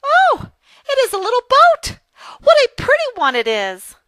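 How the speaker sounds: very high, loud burst of excitement; delighted exclamation. Acted. Lucy sees the little boat, exclaiming in high, loud delight. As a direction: exclaim with big delight, easing down in pitch.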